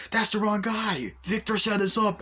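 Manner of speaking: high-pitched imitated cry of alarm; mock alarm. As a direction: cry it out high, retelling the scene. Acts a man shot in raid.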